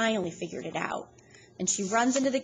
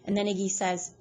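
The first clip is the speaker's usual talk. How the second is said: ordinary delivery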